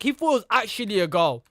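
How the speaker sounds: ordinary talk, nothing marked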